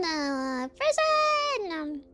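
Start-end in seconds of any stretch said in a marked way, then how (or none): 0.7-1.7 excited. Real, not acted. Excited repeat of a word in game.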